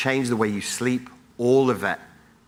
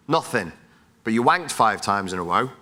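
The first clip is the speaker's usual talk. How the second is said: ordinary talk, nothing marked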